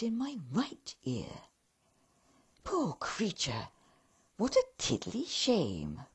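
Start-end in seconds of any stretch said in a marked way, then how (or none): 2.6-6.0 lightly voiced second elf, pitying; a hint of a cheery elf tutting; mild pitying elf.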